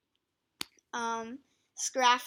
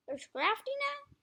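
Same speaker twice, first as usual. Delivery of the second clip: surprised; 13 semitones higher than usual. Real, not acted. Surprised at ranking change.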